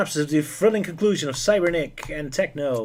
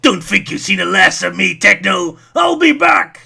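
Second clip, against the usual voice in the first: hammed-up villain, louder, rising to a high shouted I'll be back; mock cartoon-villain parting threat; 5 semitones higher, 10 dB louder, slower than usual. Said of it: "almost sounds like he's the villain".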